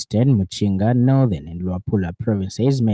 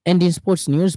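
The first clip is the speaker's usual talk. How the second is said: lifted, energetic sports intro; higher, livelier news link; 9 semitones higher, 5 dB louder than usual. Acted. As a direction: perk up for the sports section. Newsreader segment transition.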